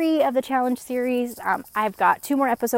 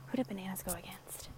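whispering; 18 dB quieter than usual. Real, not acted. Searching her memory aloud.

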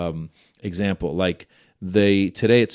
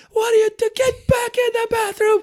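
hammed-up frazzled dad; exasperated dad. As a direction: order the kids back in. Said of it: "I'm like".

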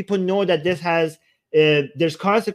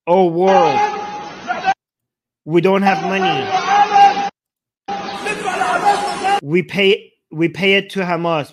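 half-hearted mock shout, louder on the plea; imitated rooftop shout; 3 semitones higher, 4 dB louder than usual. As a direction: call out like the man on the roof, not full force. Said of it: "shouting in Gaza".

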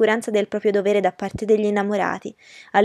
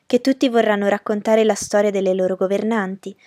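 usual speaking voice

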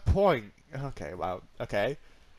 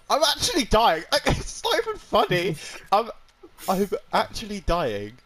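watery-eyed, laughing through hay fever; teary from hay fever; 8 semitones higher, 5 dB louder than usual. Real, not acted. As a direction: talk through streaming eyes. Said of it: "My eyes are watering".

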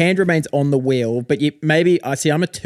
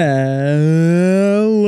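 cringey host greeting; 3 semitones higher, 4 dB louder than usual. Pretend. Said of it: "That's real, that gave me cancer".

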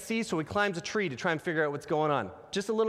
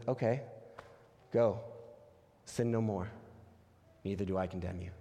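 gentle Jesus; 9 semitones lower, 6 dB quieter than usual. Retold or imitated. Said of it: "he says".

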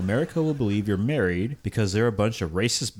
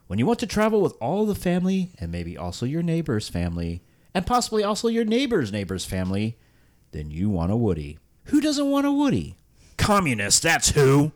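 upbeat car commercial pitch; ad read; 5 semitones higher than usual. Acted. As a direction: sell it like a fifties ad.

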